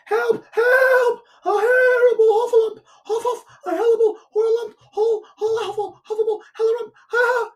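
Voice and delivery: very high squeal, words tumbling into gibberish; squeaky, rushed cries of alarm; panicked Piglet crying out. A narrator's reading. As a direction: gabble in terror as a tiny piglet. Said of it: "still crying out".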